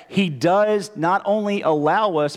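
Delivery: ordinary delivery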